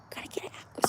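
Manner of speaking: sheepish whispered aside. Real, not acted. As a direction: breathe it out as a secret. Joking aside after crude remark.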